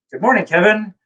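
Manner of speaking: high, bright morning hello; lightly voiced Terry Jones; cheery greeting. Terry Jones greeting him at breakfast, cheerily. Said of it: "he's like".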